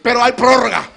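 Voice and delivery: loud, high, impassioned preaching; fiery sermon climax, almost shouting; preaching at full force. Acted. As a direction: preach it at the top of your voice.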